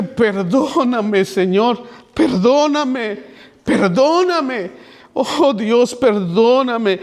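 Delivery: high, slow, drawn-out begging for forgiveness; frightened pleading. Retold or imitated. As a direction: plead in fear, stretching each perdóname. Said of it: "sentí temor". (súplica asustada, aguda y lenta)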